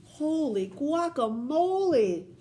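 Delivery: stretched, amazed holy guacamole; playful amazement. Real, not acted. Sees big bowl of cookies.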